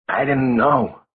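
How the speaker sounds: cracking with guilt. A narrator's reading. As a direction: voice breaking as he protests. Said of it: "Simon's voice cracked".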